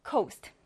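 coast with a long O vowel, softly; long o sound. Shown as a demonstration. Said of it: "O 발음으로 읽어줍니다". (coast를 O 발음으로 부드럽게)

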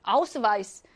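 아우스바이스 with the w said as v; v sound. Shown as a demonstration. Teacher shows how German W sounds, 아우스바이스 with a v. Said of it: "독일어에서 브로 발음하죠".

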